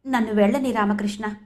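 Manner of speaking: ordinary delivery, nothing marked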